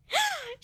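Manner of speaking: sharp high gasp of delight; a high thrilled gasp. Acted. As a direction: gasp as if you just struck gold. Sudden delighted realisation.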